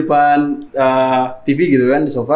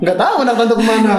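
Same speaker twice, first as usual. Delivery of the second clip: lightly laughing mock answer; a giggly playful echo; laughing joke reply; 6 semitones higher than usual. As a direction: repeat it still giggling. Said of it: "kan gitu".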